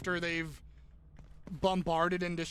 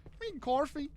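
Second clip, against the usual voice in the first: higher-pitched fake Australian; bad Australian accent; 6 semitones higher, faster than usual. Repeating the typo to co-host for laughs, bad Australian accent. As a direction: put on a clumsy Australian lilt. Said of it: "little bad Australian moment".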